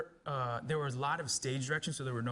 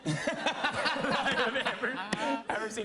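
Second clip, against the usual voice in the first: slow insult broken by laughter; retold insult, half laughing; laughing quote; 9 semitones higher, 5 dB louder, much slower than usual. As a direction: laugh through the director's verdict. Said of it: "he turned to me, he's like".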